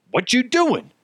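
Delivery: mock-angry reply from the texter's friend; pissed off. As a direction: fire the question back, pissed off. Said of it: "he's, like, all pissed off".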